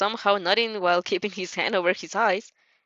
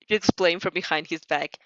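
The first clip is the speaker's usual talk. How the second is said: usual speaking voice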